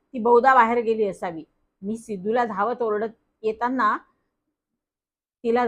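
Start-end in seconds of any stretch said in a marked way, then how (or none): none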